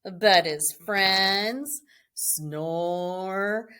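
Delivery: slow, sinking read-aloud refrain; storybook refrain. A narrator's reading. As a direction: bedtime refrain read slowly. Closing refrain.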